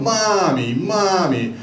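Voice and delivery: put-on lightly childlike, falling mommy calls; little girl whining. Little girl in line pestering her mom, childlike. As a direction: tug at mom with the word. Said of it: "she keeps going".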